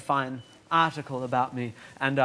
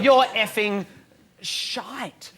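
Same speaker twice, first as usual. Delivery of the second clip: imitated heckler; 6 semitones higher, 3 dB louder, much slower than usual. Comedian retells this guy's heckle, mock-aggressive. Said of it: "this guy's gone".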